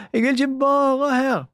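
high, loud, panicked cry; desperate shout. A narrator's reading. Julie trapped in the closet, shouting desperately. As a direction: scream that you want out.

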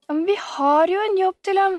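high, bursting interruption; eager objection. A narrator's reading. Camilla answering Sebastian's letter, breaking in eagerly.

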